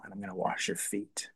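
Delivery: hushed, breathy whisper of Jesus' words; softly whispered promise. Retold or imitated. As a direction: drop into an intimate whisper. Jesus quoted at the table.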